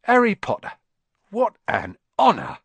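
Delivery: put-on high, loud, overjoyed old voice; thrilled barman. Barman greets famous Harry, high and thrilled. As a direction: gush with delight.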